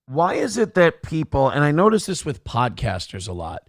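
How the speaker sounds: ordinary delivery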